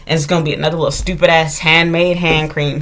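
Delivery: normal talking voice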